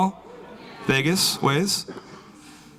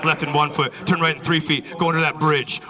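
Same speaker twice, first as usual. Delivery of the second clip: ordinary talk, nothing marked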